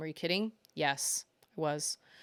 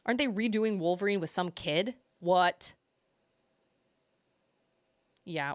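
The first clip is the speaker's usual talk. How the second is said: normal talking voice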